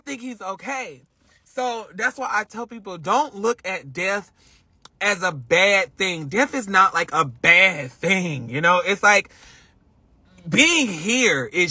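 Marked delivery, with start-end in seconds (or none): none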